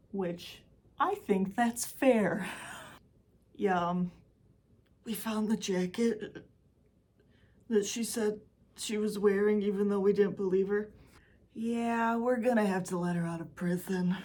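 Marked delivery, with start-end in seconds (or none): 5.0-14.2 lightly voiced grudging police officer; a hint of a reluctant cop on a call; police on the phone. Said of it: "I'm guessing that's how the phone call went".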